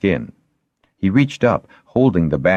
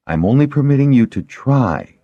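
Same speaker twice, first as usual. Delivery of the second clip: high-pitched line landing firmly on "try"; stress on try; 9 semitones higher, 4 dB louder than usual. A narrator's reading. Shara cautions her patient about the bandage, stressing "try". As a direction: lean on the final word "try". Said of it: "She stressed the last word".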